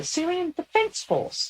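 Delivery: high, slow, squeaky voice; Leica. Acted. Channeled lieutenant names her service to the veteran, squeakily. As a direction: state it slowly in a high squeak. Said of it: "somebody that has a very squeaky voice".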